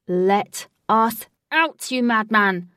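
loud, drawn-out demand; angry scream. A narrator's reading. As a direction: shout furiously at the captor. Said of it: "screamed Wraith".

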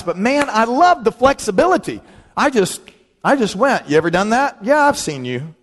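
ordinary delivery

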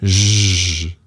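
"j" held long with no attack. Shown as a demonstration. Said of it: "just a long".

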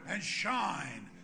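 loud, rising preacher's prayer. Acted. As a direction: pray it over the people with full force. Leads closing prayer.

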